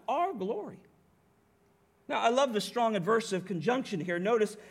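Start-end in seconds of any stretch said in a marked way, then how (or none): 0.1-0.8 high, marvelling emphasis on "our"; stress on OUR. Shown as a demonstration. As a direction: lift "our" high to point it out. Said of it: "listen to that".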